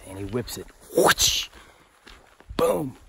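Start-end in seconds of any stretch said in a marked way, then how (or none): 1.0-1.5 loud airy whoosh; whoosh effect. Retold or imitated. Imitating thrown thunderbolt. 2.6-3.0 boom effect. Retold or imitated. Imitating thunder.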